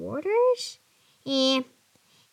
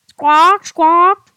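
parrot squawk; 6 semitones higher, 11 dB louder than usual. Acted.

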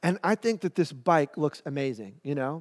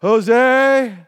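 loud, higher parental shout; dad calling; 6 semitones higher, 9 dB louder than usual. Retold or imitated. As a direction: call for the kid across the house. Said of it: "and then I hear my dad".

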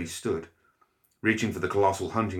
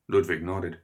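ordinary delivery

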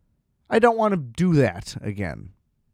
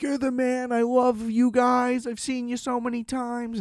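much higher gushing fan voice; fanning out; 11 semitones higher, 4 dB quieter than usual. Retold or imitated. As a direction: gush at him like a starstruck fan, pitched up. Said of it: "I just fanned out".

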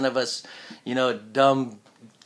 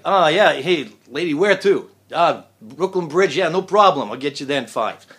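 lightly voiced New York cab driver accent, louder; a hint of a cartoon New York cabbie; New York taxi driver; 6 dB louder than usual. Shown as a demonstration. Actor demoing a New York taxi driver, light accent. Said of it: "a New York taxi driver".

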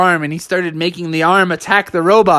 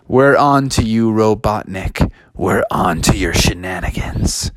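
lower, slow, growing menace; stern heroes; 7 semitones lower than usual. Acted. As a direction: warn the villain. Heroes confront Robotnik.

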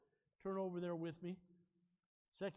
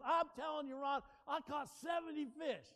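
higher, raised-voice protest; insistent retort; 5 semitones higher, 5 dB louder than usual. Retold or imitated. Fisherman defending his catch to a doubting friend, insistent. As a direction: insist on it, a little heated. Acted insistence in a retold argument.

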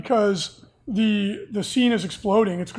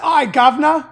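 louder, higher mock-Cockney; hammed-up Cockney call; Cockney accent; 6 semitones higher, 8 dB louder than usual. Co-host correcting a friend's English accent, hammed-up Cockney. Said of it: "The Cockney accent".

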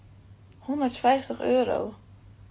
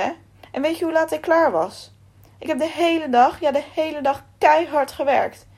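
raised, loud and indignant rant; angry outburst; 3 semitones higher, 5 dB louder than usual. Acted. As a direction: let Akos vent his fury at the boss. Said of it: "Akos viel meteen uit".

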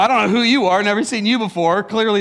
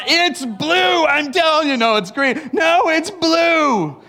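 stubborn mock arguer; 8 semitones higher, much slower than usual. Acted stubborn argument about colour.

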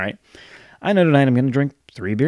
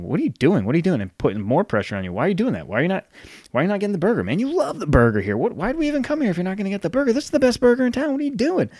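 mock incredulous friend, much higher and rising; nagging friend; 9 semitones higher, faster than usual. Friend at dinner mocking the salad order, high incredulous voice. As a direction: squeal in disbelief at the skipped burger.

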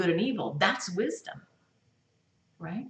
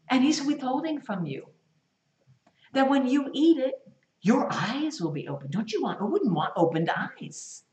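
ordinary talk

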